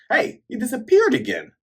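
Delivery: high mock surprise; surprised. Narrator reacting as Kuro vanishes, high surprised voice. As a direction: squeak of disbelief.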